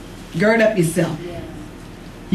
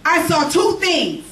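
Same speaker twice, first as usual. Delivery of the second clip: raised, insistent preaching voice; 5 semitones higher than usual. Acted. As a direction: preach it higher and harder. Preacher builds intensity.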